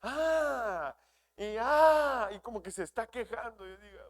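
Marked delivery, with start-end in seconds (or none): 0.0-0.9 high wailing "ah, ah" like a newborn; imitated newborn cry. Pastor imitating his newborn son's cry for the congregation. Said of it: "así como lloran los nenes". 1.6-2.3 high wailing "ah, ah" like a newborn; imitated newborn cry. Pastor imitating his newborn son's cry for the congregation. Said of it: "así como lloran los nenes".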